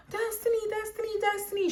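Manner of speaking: high, held-back mock wail of a name; imitated crying scream. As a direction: imitate a distraught caller in a high, unraised voice. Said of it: "screaming my name and crying".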